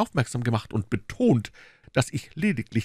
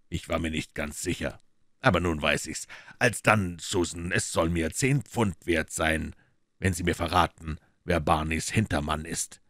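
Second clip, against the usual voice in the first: lower, calm, mocking Holmes; cool detective teasing the maid; dry detective; 4 semitones lower than usual. Holmes coolly needling the maid, lower voice.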